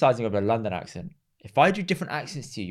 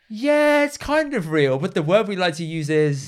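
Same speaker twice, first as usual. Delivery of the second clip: high, drawn-out hedging reply; reluctant admission; 5 semitones higher, slower than usual. Retold or imitated. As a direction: stretch it out high, then ease down to explain. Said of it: "And he's like".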